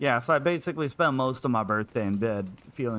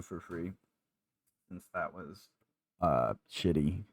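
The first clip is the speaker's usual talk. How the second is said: robot voice filter; 6 semitones lower, 7 dB quieter than usual. Shown as a demonstration. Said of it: "I'll do the robot voice for you".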